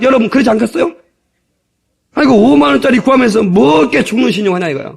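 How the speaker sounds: ordinary delivery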